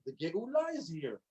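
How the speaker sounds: suddenly soft, awed repeat; hushed wonder. Acted. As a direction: let the amazing news sink in quietly.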